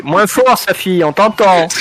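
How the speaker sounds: loud, high in-character yell; shouting. Acted. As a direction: yell the warning across the room. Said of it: "Et là, je crie".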